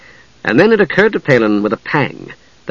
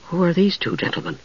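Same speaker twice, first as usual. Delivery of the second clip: higher, much softer woman's voice; hushed inquiry; 6 semitones higher, 8 dB quieter than usual. A narrator's reading. Usha asking Tass about two strangers, hushed.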